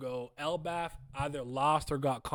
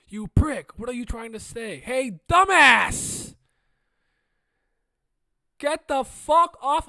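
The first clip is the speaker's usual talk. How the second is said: angry yelling; 9 semitones higher, 16 dB louder, slower than usual. Acted. Yells manga fight lines.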